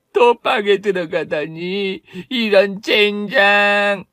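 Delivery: high, slow, bitter mocking voice; bitter cackle. Dragged-off gambler laughs bitterly at going back to jail, slow high cackle. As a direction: cackle then drag out the curse.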